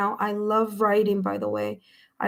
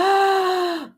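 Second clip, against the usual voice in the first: high, drawn-in gasp; amazed gasp; 8 semitones higher than usual. Real, not acted. Reader recognizes the other person from the reading, amazed gasp. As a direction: gasp as the pieces fit. Viewer's message confirms reading.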